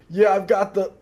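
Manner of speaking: strained high words between imagined heaves; slow, hammed-up queasy groan; mock throwing up. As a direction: act out talking while being sick. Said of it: "I just kept throwing up".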